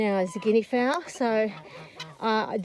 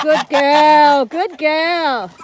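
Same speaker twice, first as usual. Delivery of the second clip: loud praise; 4 semitones higher, 9 dB louder, much slower than usual. Real, not acted. Praising dog loudly.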